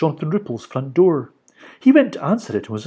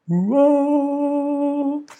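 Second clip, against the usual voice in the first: high, ringing held call; pure clear voice; 14 semitones higher than usual. A narrator's reading. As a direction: hold it out, bright and pure. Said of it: "said the Spirit of the Rainbow".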